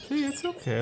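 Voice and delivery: high, soft reassurance; calm the bird down gently; soothing pet voice. Real, not acted. To the nervous birds, a high soft hush.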